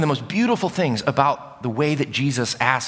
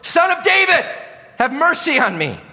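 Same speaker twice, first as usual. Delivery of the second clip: very high, loud cry for mercy; louder shouted plea; 5 dB louder than usual. Acted. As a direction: shout it all the more, insistently. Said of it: "he just shouted all the more".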